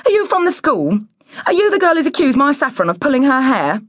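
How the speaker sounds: high-pitched, demanding woman; snooty interrogation. A narrator's reading. Woman questioning strangers at her door, high and demanding. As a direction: fire off the questions, high and quick.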